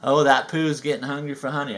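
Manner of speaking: ordinary delivery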